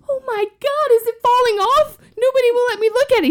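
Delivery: high, fast, panicky squeal; panicked teen. Retold or imitated. Teen asks the doctor if her nose is falling off, high and panicky. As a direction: freak out like a scared kid.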